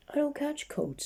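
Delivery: very high defiant denial; stubborn insisting. Acted. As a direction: squeak out the denial. Stubborn denial, higher take.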